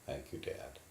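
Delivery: a small, breathless whisper. Acted. Son whispering thanks to his father after the beating.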